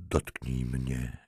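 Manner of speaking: slow, soft, low throaty plea; throaty cry. A narrator's reading. Halinka to her lover in the dark, throaty, understated cry. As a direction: keep it low and throaty, drawn out. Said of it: "krzyknęła gardłowym głosem".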